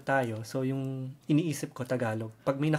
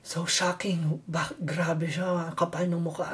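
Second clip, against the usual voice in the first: scandalized gossip; 4 semitones higher, slower than usual. Retold or imitated. Filipino scandalized by blunt coworker.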